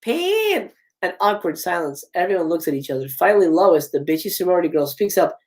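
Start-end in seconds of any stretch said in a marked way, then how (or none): 0.0-0.7 very high, stretched mock-Russian wail; attempted Russian accent. Reader retries Sydney's stage line, shaky-skilled Russian accent. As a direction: pitch it way up, badly faked Russian accent. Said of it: "how to do a Russian accent".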